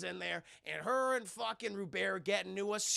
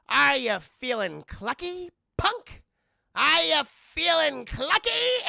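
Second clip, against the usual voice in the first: high, loud, slow mock tough-guy taunt; chicken Dirty Harry; 8 semitones higher, 10 dB louder, slower than usual. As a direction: ham up a clucking Clint Eastwood threat. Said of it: "like Clint Eastwood's character".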